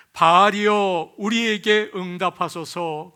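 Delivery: loud, drawn-out plea to a god; imitating priests crying out in prayer; fervent crying out. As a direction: call out slowly on the name, then plead. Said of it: "그러면서 열심히 부르짖었습니다".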